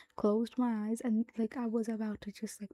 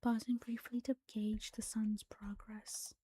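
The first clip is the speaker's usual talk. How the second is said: whispered story reading. Acted. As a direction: read the story in a hushed whisper.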